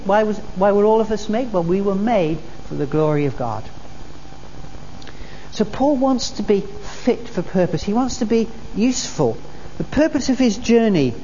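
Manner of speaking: normal talking voice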